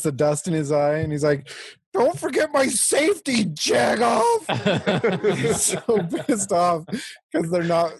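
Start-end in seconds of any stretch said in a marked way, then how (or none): 1.9-5.4 mock angry shout. Hero's angry yell at shooters.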